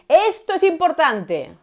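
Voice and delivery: loud slow emphasis; loud emphatic demo. Shown as a demonstration. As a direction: declare it as the big point. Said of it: "lo normal es que nos salga decir".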